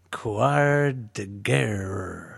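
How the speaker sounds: put-on very slow, half-hearted French accent; weak French accent. Host's second take after co-host asks for better French, weak French accent. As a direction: stretch Quad de Guerre out in a shaky French accent. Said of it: "that's still not quite it".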